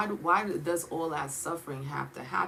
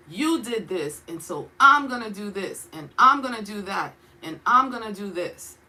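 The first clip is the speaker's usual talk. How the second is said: louder, punishing God, each threat pressed hard; stern judgment; 3 semitones higher, 6 dB louder than usual. Acted. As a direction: lay down the sentence like a stern judge. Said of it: "The first way I read it is".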